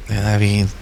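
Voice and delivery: low, even answer to own question; low-pitched refrain; hushed answer. Real, not acted.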